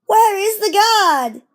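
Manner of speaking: high, loud worried cry; anxious little engine calling. Acted. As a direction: call out, getting anxious.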